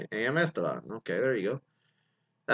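ordinary talk, nothing marked

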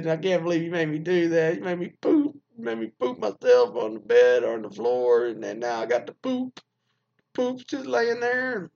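imitating a wife wailing at her husband; mock hysterical wife. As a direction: play the sobbing wife, half-joking.

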